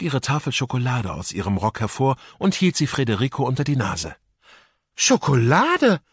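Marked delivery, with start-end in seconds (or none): 4.9-6.0 high, slow, stretched-out disbelief; can't believe his eyes at the chocolate; unbelieving cry. A narrator's reading. Pirate boy seeing Lara's chocolate, unbelieving slow cry. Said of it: "rief der Piratenjunge ungläubig".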